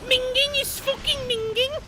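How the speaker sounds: put-on high, hammy British accent; overdone British slang; bad British accent. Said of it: "really bad British accents".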